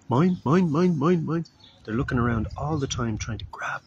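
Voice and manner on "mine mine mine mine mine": high, loud repeated squawk; cartoon seagulls. Preacher imitating cartoon seagulls, high loud squawks. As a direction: squawk like the greedy cartoon gulls. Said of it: "those seagulls in that cartoon".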